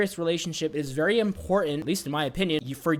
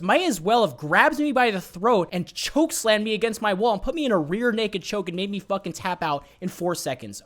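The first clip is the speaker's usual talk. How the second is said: ordinary delivery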